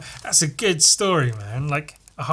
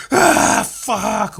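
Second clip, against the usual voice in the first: loud, high imitated yell of pain; sudden startled scream; 6 semitones higher, 6 dB louder than usual. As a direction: erupt as if bitten awake. Sleeper suddenly bitten, yells out.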